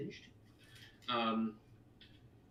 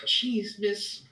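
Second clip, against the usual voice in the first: put-on whiny child; 8 semitones higher, 4 dB louder than usual. Boy protests.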